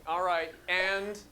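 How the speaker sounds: usual speaking voice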